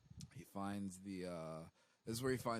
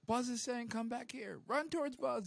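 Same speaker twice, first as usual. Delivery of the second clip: high, loud eager yelling; excited shouting; 6 dB louder than usual. Retold or imitated. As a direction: shout it out all worked up. Cadets misrelaying Buzz's order.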